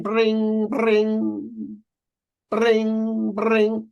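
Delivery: mock ring of an old landline; a high imitated phone ring. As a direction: sing out each ring like a phone bell.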